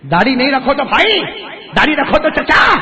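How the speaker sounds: loud, high punchline with a laugh. Real, not acted. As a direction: shout the punchline and laugh with the crowd. Punchline to crowd. (ہنستے ہوئے اونچی آواز میں)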